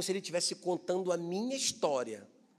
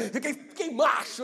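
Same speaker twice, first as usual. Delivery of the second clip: laughing; 7 semitones higher, 7 dB louder than usual. Real, not acted. Laughing at his own boldness.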